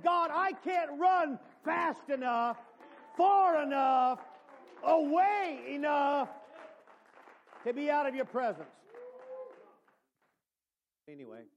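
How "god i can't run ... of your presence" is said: full-force preacher's plea; preaching hard. Real, not acted. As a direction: stretch each phrase in desperation.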